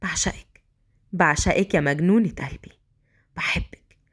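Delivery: low, hushed male declaration; Mazen, passionately in love. Acted. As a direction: murmur adoration to his beloved. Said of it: "بكل عشق".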